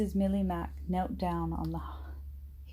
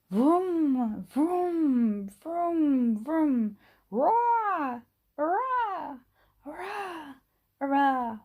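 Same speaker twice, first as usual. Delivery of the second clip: high, loud vroom and hurrah; roaring train and cheers; 9 semitones higher, 5 dB louder, much slower than usual. A narrator's reading. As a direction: roar it out then cheer like children.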